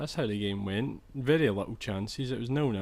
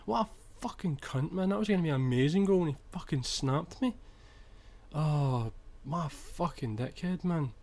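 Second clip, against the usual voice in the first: frustrated; 3 semitones higher than usual. Real, not acted. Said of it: "I'm a little bit pissed off still".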